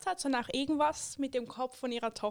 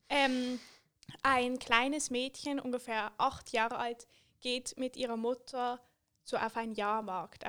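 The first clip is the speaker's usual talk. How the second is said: ordinary talk, nothing marked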